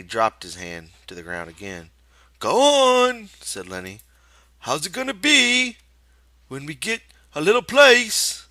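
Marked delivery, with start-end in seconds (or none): 2.4-3.3 put-on high, loud childlike giant; Lenny's voice. A narrator's reading. Lenny's voice in the story. 4.6-8.5 put-on high, loud childlike giant; Lenny's voice. A narrator's reading. Lenny's voice in the story.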